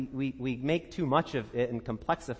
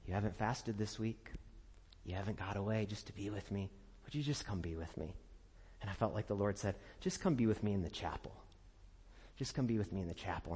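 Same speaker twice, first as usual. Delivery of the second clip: quiet voice of the Lord; 7 semitones lower, 11 dB quieter, faster than usual. Retold or imitated. Voicing the Lord's call.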